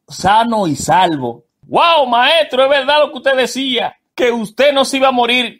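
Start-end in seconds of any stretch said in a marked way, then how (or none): 1.7-5.6 amazed. Acted. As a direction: marvel at the survivor. Vecino asombrado al verlo vivo.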